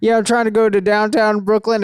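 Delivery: lightly voiced clueless rider; mock clueless guy. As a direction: play the oblivious man asking the conductor. Imitating clueless man on train.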